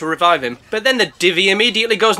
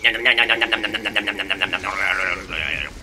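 low, fading nom nom; munching sharks; 10 semitones lower, 5 dB quieter than usual. Acted. Baby sharks eating inside a corpse, low fading munching. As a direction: chomp away like little sharks.

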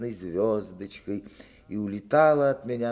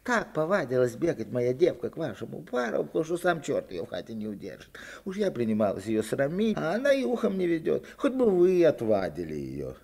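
mock grumbling mother, a touch higher; joking complaint; 6 semitones higher than usual. Petrikha joking complaint to the neighbour. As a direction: complain with a wink. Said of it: "шутливо жалуется Петриха".

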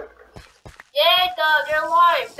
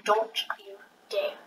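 soft, low whispered warning; 6 semitones lower, 11 dB quieter than usual. Real, not acted. Threat whispered in game.

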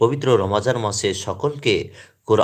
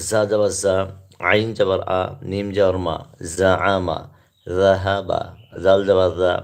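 spelling recitation; 3 semitones lower than usual. Shown as a demonstration. Teacher spelling Arabic words aloud to viewers, rhythmic drill. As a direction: chant each syllable crisply so learners can follow. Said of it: "পাঠ করছি, আরবি উচ্চারণগুলি বানান করে পড়ছি".

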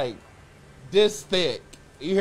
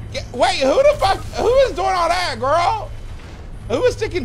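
shocked disbelief; 9 semitones higher, 3 dB louder than usual. Real, not acted. Shocked at jelly beans story.

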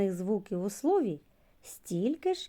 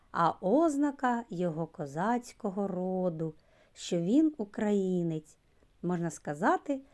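ordinary delivery

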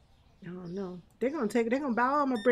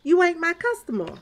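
higher, sassy dismissal of a cheap customer; sassy brush-off; 7 semitones higher than usual. Retold or imitated. Store owner dismissing a customer who complains about prices, sassy and high. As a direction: shoo them off to another store. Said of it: "I'm like".

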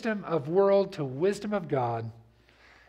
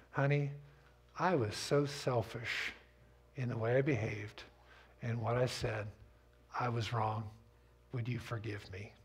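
quiet, lower, slowed apology to a spouse; quiet heartfelt apology to a spouse; soft sincere apology; 6 semitones lower, 8 dB quieter than usual. Shown as a demonstration. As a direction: softly own your fault to your honey. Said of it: "Rick Warren said one time".

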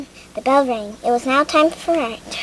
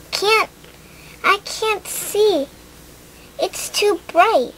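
put-on dazzled child; 7 semitones higher, slower than usual. Lily dazzled in the dream.

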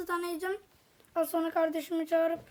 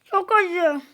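burning reaction; 7 dB louder than usual. Real, not acted. Reacting to hot chips.

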